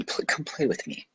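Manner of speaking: gently invite, lightly voiced boy; prince's invitation. A narrator's reading. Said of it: "proposed the little prince".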